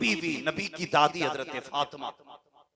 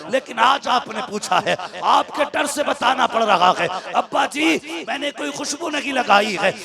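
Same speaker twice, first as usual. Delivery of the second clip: loud, high, impassioned sermon voice; full-throated preacher's cry; fiery preaching; 7 semitones higher, 12 dB louder than usual. Acted. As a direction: preach it at full force to the crowd. Preaches the climax at full force.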